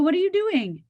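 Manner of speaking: sharp, high, startled question; surprised question. Retold or imitated. Mother startled to see daughter scratching out her work. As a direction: jump up in pitch, caught off guard.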